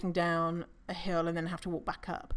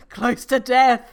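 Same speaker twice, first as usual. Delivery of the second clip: loud gasping, higher and strained; mock out of breath; 4 semitones higher, 11 dB louder, slower than usual. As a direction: gasp it out as if collapsing after a hill. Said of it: "out of breath".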